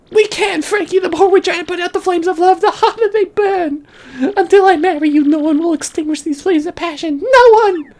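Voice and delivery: very high, loud impassioned falsetto; melodramatic lover. Acted. Daughter vows love to boyfriend, hammed-up shrieking falsetto. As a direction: declare undying passion, building to a cry. Francesca declares her love.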